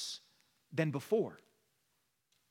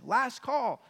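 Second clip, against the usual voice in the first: mock call; 8 semitones higher, 6 dB louder than usual. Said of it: "We see her saying".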